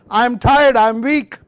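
loud, high whine of weariness; mock tired complaint. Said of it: "what voice I'm listening".

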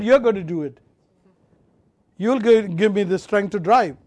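ordinary talk, nothing marked

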